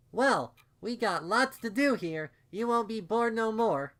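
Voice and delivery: put-on high, loud child's voice; excited little filly. A narrator's reading. Little unicorn inviting her aunt to play, high and excited. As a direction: bubble over like a small kid.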